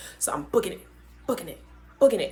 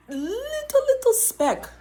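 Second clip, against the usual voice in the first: high squeaky little; 5 dB louder, slower than usual. Shown as a demonstration. Stretching the word little.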